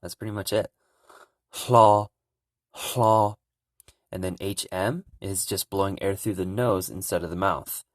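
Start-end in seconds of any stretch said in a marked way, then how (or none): 1.5-3.4 "hlo" with breathy H puffed out before the L; teacher drilling "hlo" syllable; Hmong hlo. Shown as a demonstration. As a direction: breathe the H then slide into L on "hlo". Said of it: "hear the H coming out of my mouth before I say the L".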